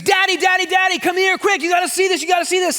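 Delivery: put-on high, loud kid's call for Daddy; high-pitched excited kid shouting; excited child shouting. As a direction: yell like an eager little boy. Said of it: "just started shouting from the bathroom".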